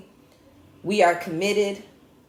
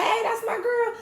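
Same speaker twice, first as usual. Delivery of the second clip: mock cheer. Fans cheering her on the app.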